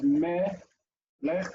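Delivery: "me, lech" in two separate pieces; syllable by syllable. Shown as a demonstration. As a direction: break it apart, pausing between "me" and "lech". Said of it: "dividing words into syllables".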